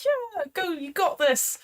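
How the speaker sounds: high cheer from the sidelines; high-pitched, encouraging cheer. Acted. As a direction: cheer on a friend from behind. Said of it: "you hear more cheering behind you".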